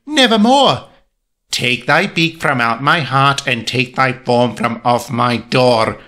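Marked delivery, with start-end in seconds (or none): 0.1-0.8 high-pitched talking raven; put-on high bird voice; raven's voice. A narrator's reading. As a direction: pipe up as the raven, well above the narrator. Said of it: "quoth the raven".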